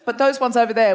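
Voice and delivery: ordinary delivery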